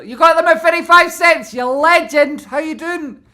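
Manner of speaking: very loud, high cheering; cheering a viewer's lucky buy; hyped yell. Real, not acted. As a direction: shout it like a cheerleader.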